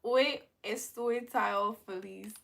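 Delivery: slow, steady hoy estoy tan feliz; slow careful Spanish. Shown as a demonstration. Streamer repeating the Spanish phrase, slow and careful. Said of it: "I said I'm so happy".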